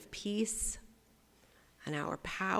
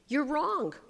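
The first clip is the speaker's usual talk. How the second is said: WRONG punched high and loud; blunt stress on wrong; emphatic you're wrong; 8 semitones higher, 5 dB louder than usual. Shown as a demonstration. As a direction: hit the word wrong hard, high. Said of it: "How's that for a pastoral response?".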